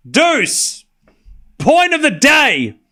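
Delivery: loud, drawn-out call; excited shout. Real, not acted. Caller after a great rally, shouting excitedly. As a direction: savor it at full volume. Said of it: "Why are you screaming?".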